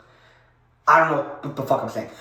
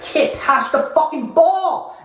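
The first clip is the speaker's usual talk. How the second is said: higher, louder, slow drawn-out mock yell; imitating a yell; 10 semitones higher, 4 dB louder, much slower than usual. Player yelling at the ball boy for the ball, mock yell. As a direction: bark it at the dreamy kid. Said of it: "He yelled at me".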